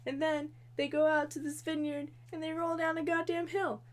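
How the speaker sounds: ordinary talk, nothing marked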